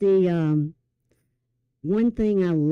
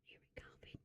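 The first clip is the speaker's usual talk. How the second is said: breathy hushed whisper; 35 dB quieter than usual. Real, not acted. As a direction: whisper it under your breath. Joking aside about her cough.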